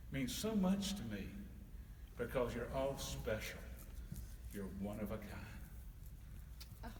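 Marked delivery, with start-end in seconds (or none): 4.5-6.9 very slow, soft and low; tender. Real, not acted. Santa telling the children they are special, tender.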